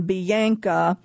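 Bianca said slowly with vowels stretched into diphthongs; elongated Australian vowels. Retold or imitated. Narrator gives an example of her accent, Bianca with drawn-out vowels. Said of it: "elongating vowels into".

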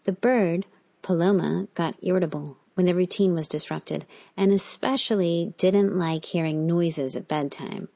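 ordinary delivery, nothing marked

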